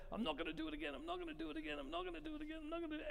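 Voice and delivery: high, soft repeated promise to yourself; a hushed, pleading I-won't-do-it-again voice; mock penitent vow. Parishioner walking out of confession, vowing never again in a high, hushed voice.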